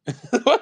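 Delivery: sudden high burst of laughter; loud laugh. Real, not acted. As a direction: crack up at the joke. Laughing at own mock quote.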